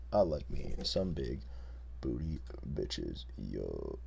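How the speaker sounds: very low, slow mumbling bro. Pretend. Repeat even lower.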